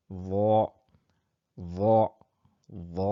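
ظ spoken with emphasis; Arabic letter zah. Shown as a demonstration. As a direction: say য thick, tongue tip on the upper teeth. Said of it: "জিভের পেছনের অংশটা কিন্তু উপর দিকে চলে যাচ্ছে".

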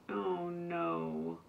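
a mild storybook sob; light sob of oh no; hint of a child sobbing. A narrator's reading. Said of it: "Ava sobbed".

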